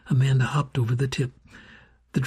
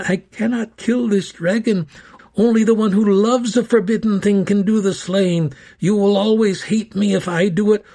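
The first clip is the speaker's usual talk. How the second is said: put-on old man's urgent reply; 6 semitones higher, 6 dB louder than usual. A narrator's reading. Old man answers urgently in battle.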